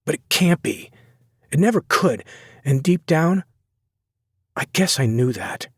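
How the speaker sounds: high, aching resignation; wounded and giving up; hurt young woman. A narrator's reading.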